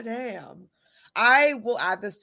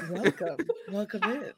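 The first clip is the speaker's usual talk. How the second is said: softer, hushed welcome; a hint of a mysterious host; mock interview voice; 6 dB quieter than usual. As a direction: lightly imitate her smooth host voice. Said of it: "whenever you turn that voice on".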